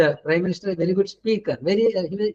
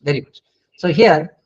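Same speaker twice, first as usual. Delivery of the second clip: usual speaking voice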